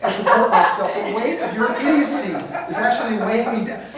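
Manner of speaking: loud, hammed-up exasperation; exasperated put-down. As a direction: lay out the scorn behind an eye roll. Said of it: "what he's saying is".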